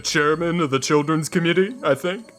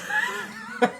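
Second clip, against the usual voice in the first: a squeaky, sky-high laugh; high-pitched giggle; 4 dB quieter than usual. Real, not acted. Laughing at own joke.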